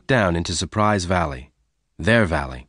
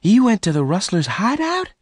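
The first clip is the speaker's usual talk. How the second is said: high-pitched horrified question; 13 semitones higher, 4 dB louder than usual. A narrator's reading. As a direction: gasp it out high, appalled at the risk. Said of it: "Bess asked in horror".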